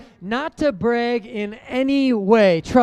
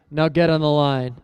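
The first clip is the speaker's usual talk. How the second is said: a stern coach's order, pitched lower; super serious coach; 8 semitones lower than usual. Retold or imitated. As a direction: drop the smile and give a low, serious command. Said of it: "He gets super serious".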